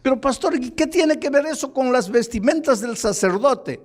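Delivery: high-pitched imagined congregant questioning the pastor; puzzled listener. Acted. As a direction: raise your voice high, as a listener challenging the preacher. Voices a listener's objection to pastor.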